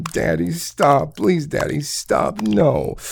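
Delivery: high, slow mock pleading. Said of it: "I love hearing you scream".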